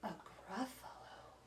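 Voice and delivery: slow, hushed snake whisper. Acted. Snake luring the mouse to its log pile, whispered. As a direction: breathe it out slyly like a snake.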